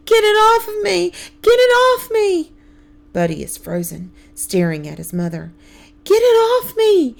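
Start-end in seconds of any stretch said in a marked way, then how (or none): 0.1-2.5 high, loud panicked scream. A narrator's reading. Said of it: "smacking her back and screaming". 6.0-7.2 high, loud panicked scream. A narrator's reading. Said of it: "Aunt Minnie screams".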